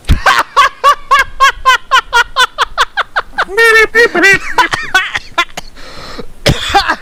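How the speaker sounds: long high wheezing laugh that leaves him gasping; breathless laughing fit. Real, not acted. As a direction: laugh until you run out of air. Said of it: "Breathe, Steve-O".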